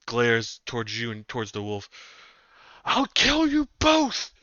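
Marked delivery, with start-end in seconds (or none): 2.9-4.3 high, slow furious yell; furious threat. Acted. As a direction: scream the threat with hate. GM voices enraged captive.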